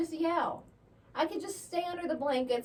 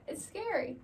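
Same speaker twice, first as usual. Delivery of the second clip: ordinary talk, nothing marked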